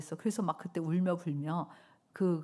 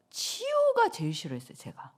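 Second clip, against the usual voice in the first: high exaggerated confession; 6 semitones higher, 3 dB louder than usual. Confessing old dislike of healing.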